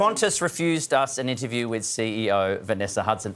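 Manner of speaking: flat robot voice, even and mechanical. Acted. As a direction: read it like a robot, no feeling. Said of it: "I said that like a robot".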